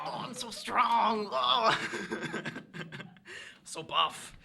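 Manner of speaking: slow, high, loud mock boast; mock showing off. Weakling boasting on a dating profile, mock showing off. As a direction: drag it out in hammed-up self-admiration.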